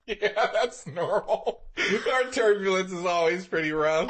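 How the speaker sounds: a high, slow, laughing blasé voice; mock blasé New Yorker. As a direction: shrug it off as a New Yorker, slowly. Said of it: "New York is probably just like".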